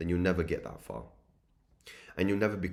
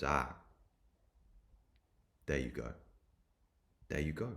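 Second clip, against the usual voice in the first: low, soft knowing acknowledgement; quiet realization; 5 semitones lower, 6 dB quieter than usual. Retold or imitated. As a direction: let it land gently, with long pauses. Lecturer's knowing reply in retold exchange.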